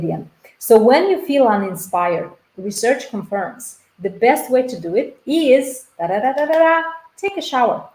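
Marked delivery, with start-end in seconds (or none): 6.0-7.9 sung da da da drumroll rising into the reveal; mock drumroll. Coach teasing viewers before the answer, playful drumroll. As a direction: build suspense with a playful drumroll, pitch climbing.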